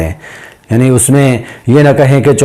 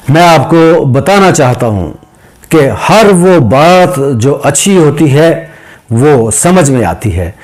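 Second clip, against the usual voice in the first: raised orator's line broken by short pauses; pauses in a speech. Shown as a demonstration. Teacher showing students where to pause in an address, measured oratory. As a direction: pause briefly before each phrase, then carry on. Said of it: "ये दरमियान में possess आप देख लें".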